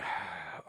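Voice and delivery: long audible sigh before giving in; weary sigh. Retold or imitated. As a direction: sigh like you're tired of this. Reluctantly agreeing to come in.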